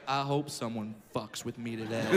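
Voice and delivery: low, cocky and quiet; swaggering. Retold or imitated. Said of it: "I walked into kindergarten with some swag".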